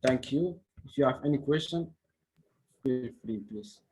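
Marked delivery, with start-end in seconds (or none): none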